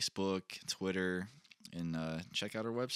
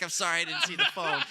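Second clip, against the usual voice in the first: higher, laughing through it; quoting girlfriend's apology while laughing; laughing quote; 7 semitones higher, 5 dB louder than usual. Retold or imitated. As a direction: giggle out her apology. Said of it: "She's like".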